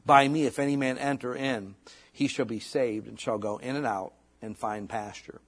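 put-on measured, solemn reading of old scripture. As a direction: read it like the King James pulpit. Reads scripture.

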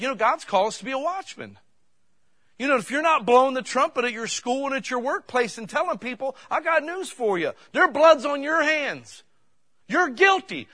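loud, high hellfire preaching at the youth; fiery preaching. Retold or imitated. Young preacher scolding the youth crowd, loud and fiery. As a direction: let them have it from the pulpit. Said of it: "I just started letting them have it".

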